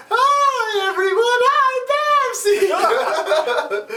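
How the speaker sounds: very high squeaky cutesy voice, laughing; cutesy Babs. Retold or imitated. Babs pops up in the background greeting everyone, high squeaky cutesy. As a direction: squeak it like a sweet cartoon girl. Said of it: "suddenly Babs is sitting there".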